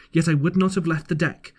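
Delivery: usual speaking voice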